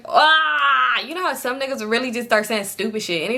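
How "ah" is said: loud, high mock "ah"; loud jeering cry; mocking outburst. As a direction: burst out with a loud, raised "ah", mocking a bragger. Mocking men who brag.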